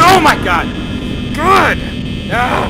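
startled yell. Real, not acted. Jump scare in horror game.

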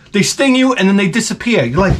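ordinary talk, nothing marked

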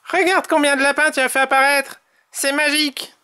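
high, quick, gleeful character voice; delighted Tom. A narrator's reading. Tom plans a game with the rabbits, high delighted voice. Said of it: "se réjouit Tom".

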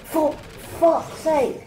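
very high, slow, stretched-out curse; drawn-out angry cry. Real, not acted. Gamer dying again in the match, drawn-out furious curse. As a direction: let the frustration burst out long.

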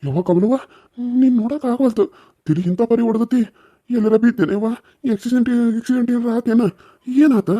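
high-pitched, weeping questions; tearful pleading. Acted. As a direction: beg through tears for an answer. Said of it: "ನೀವ್ ಹಂಗ್ ಅಳಬೇಡವೇ ಚಿಗವ್ವ". (ಅಳುತ್ತಾ ಅಂಗಲಾಚುವ ಎತ್ತರದ ಧ್ವನಿ)

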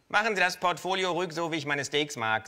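high, loud cheeky kangaroo voice; Känguru-Stimme. Acted. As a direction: play the brazen kangaroo, up high. Kangaroo voiced in live reading. (hohe, laute Känguru-Stimme)

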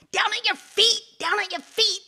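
high, loud, insistent call. Acted. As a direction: holler to be noticed from below. Hidden snake calls to passer-by.

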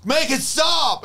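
dawning horror rising to a plea; mock realization into panic. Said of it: "you go".

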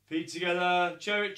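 ordinary talk, nothing marked

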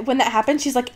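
ordinary delivery

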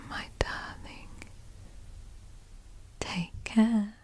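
unvoiced close-mic whisper throughout; ASMR whisper. Lover saying goodbye to partner, whispered ASMR. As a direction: breathe every word into the mic as a whisper.

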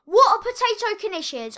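loud, high, slow bewildered cry; baffled yell. Real, not acted. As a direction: shout it out in disbelief. Baffled by video.

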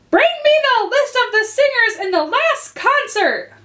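high, loud royal command; shrill queen. A narrator's reading. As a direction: bark the order like a queen. Queen orders an officer.

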